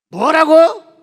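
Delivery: very high, loud disbelieving roar; outraged shout. Retold or imitated. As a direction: bark back in fury. Executioner's outraged reply.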